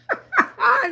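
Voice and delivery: loud high laugh breaking out; burst of laughter. Real, not acted. Laughing to viewers about Peach in the basement. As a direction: cracks up mid-line.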